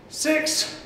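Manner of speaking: normal talking voice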